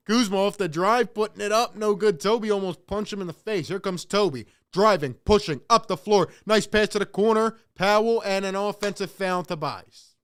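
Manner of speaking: loud, lively game call; play-by-play. Acted. Calling the game live to viewers, loud. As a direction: call each play as it happens.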